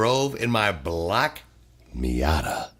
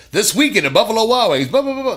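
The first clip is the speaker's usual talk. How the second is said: high, loud, fast DJ ad read; radio promo voice; 12 semitones higher, 10 dB louder, faster than usual. Retold or imitated. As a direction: hype it like a radio announcer.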